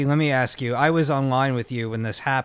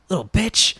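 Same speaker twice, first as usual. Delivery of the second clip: mock insult; 7 semitones higher, 3 dB louder than usual. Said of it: "She's like".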